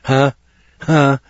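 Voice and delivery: dumbfounded. Retold or imitated. Lawyer replaying their baffled reply to the judge, dumbfounded. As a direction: play dumb for a moment. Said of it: "looked at him kinda stupid".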